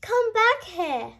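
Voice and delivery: calling out. A narrator's reading. Fox calling the hare back from the cave, loud call. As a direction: call him back in alarm. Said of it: "the fox called".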